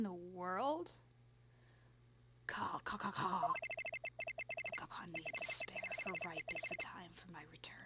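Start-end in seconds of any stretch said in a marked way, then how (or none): 2.5-3.4 crow caws. Acted. Yokai announces itself with crow cries. 3.4-7.8 eerie demon whisper. Acted. Yokai Tenmataro speaks in game.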